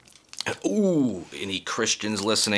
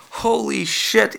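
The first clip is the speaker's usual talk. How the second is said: higher, slowed disbelief; astonished; 10 semitones higher, slower than usual. Real, not acted. Comic stunned by Trump's lap, slow high amazement. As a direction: stretch it out in disbelief.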